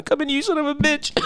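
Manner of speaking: squeaky movie voice. Acted. Movie quote acted, high.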